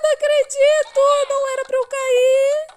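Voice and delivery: high shrill outcry; shrieking in dismay. Real, not acted. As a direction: shriek out in disbelief. Fell after taunting.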